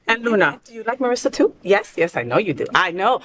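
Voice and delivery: usual speaking voice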